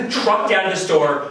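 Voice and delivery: usual speaking voice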